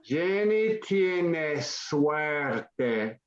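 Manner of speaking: slow model phrase. Shown as a demonstration. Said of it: "teacher models the phrase slowly".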